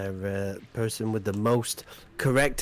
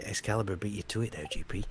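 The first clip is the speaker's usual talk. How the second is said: whispered aside; 5 semitones lower, 8 dB quieter, much faster than usual. Acted. Whispers to losing player.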